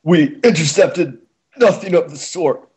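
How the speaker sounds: high, slow, strained stallion voice; high choked gasp, slowly; gasped reply. Acted. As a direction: gasp it out while being choked. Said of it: "Orion gasped in reply".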